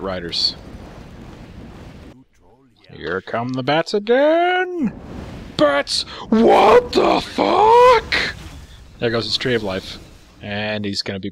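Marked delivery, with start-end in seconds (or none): 2.9-4.9 slow call climbing high; announcing the attack. Real, not acted. Caster heralding another bat raid, drawn-out announcement. Play-by-play calling the next attack. 5.6-8.4 very high, loud, stretched-out shriek; mock shocked. Commentator imitating the startled player, hammed-up shock. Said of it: "he was just completely shocked".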